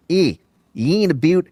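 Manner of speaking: put-on false start in a Kiwi accent. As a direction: try a Kiwi Rocky. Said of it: "I'll do it in the Kiwi then".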